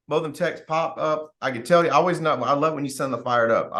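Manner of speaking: normal talking voice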